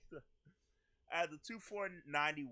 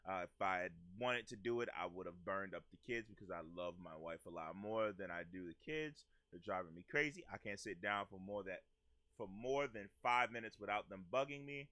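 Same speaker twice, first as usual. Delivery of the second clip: reading the husband's words; 8 semitones lower, 4 dB quieter than usual. Retold or imitated. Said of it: "Carson replied".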